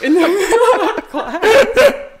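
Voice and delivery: high, loud burst of laughter mid-phrase; drawn-out words breaking into laughter; laughing. Real, not acted. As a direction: crack up as you finish the sentence. Laughing at her own story.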